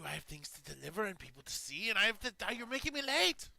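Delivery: high, loud frantic whine; panicked captive. Acted. Prisoner begging the party to release him, high frantic whine. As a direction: plead in a shrill panic to be let go.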